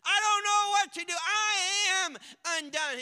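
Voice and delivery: desperate wail of a lost prophet; crying out. Acted. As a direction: cry out as a broken man before God. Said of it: "Isaiah cries out".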